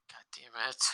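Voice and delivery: under-the-breath whisper, exasperated; whispered curse. Acted. Griping to the podcast cohost about coworkers, whispered curse. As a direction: hush it out like a defeated muttered oath.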